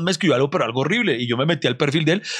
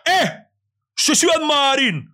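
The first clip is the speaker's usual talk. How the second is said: stranger shouting his name; 12 semitones higher, slower than usual. Retold or imitated. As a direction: call out the name from across the street. Retold stranger calling out on street. (gritar el nombre desde lejos, agudo y fuerte)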